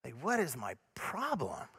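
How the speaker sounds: low, soft, slow self-blame; dejected self-reproach. Acted. Speaker voicing his own thought after failing, low soft dejection. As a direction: sink into quiet exasperation with yourself. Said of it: "the thought and the feeling is this".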